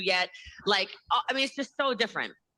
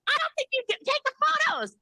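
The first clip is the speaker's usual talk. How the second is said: very high, squealed retort; mock exasperation. Recruiter retelling a photo argument, high mock-exasperated squeal. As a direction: imitate arguing in a put-on high pitch.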